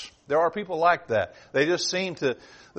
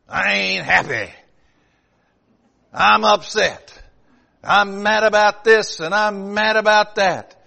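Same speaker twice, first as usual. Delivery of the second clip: slow, loud mock grumble of a sour person; grumbling complainer; 4 semitones higher, 7 dB louder, slower than usual. Preacher mocking a discontented churchgoer, slow loud grumble. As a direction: mimic a discontented whiner.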